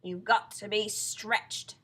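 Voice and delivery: loud, slow, drawn-out verdict; sinister husband. Acted. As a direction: savour the dreadful remedy. Husband gives the sinister remedy.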